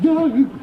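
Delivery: high, loud "ooh"; mock eerie howl. Son mocks father's snowy exploits, howling. Imitates wind over snowy wastes.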